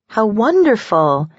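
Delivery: slow, drawn-out gushing; a touch of overdone enthusiasm; overly enthusiastic. A narrator's reading. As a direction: stretch it out with too much delight. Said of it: "answered a little too enthusiastically".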